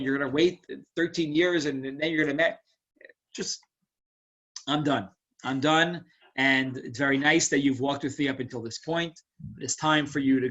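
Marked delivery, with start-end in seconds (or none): none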